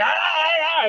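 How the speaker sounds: high, loud pained yelps; imitating a wounded dog's cries. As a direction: yelp like a hurt dog. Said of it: "He's like".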